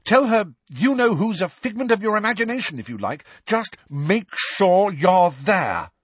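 captain's angry ultimatum, high and loud; furious order. A narrator's reading. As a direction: hammer the last words home. Angelina ends her tirade.

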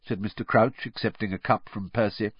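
ordinary delivery, nothing marked

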